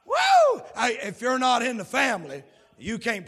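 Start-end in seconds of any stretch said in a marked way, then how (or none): 0.1-0.6 high, loud exultant whoop; joyful whoop. Real, not acted. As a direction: let out a church whoop of joy. Preacher exults mid-sermon.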